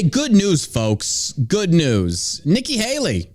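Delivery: ordinary talk, nothing marked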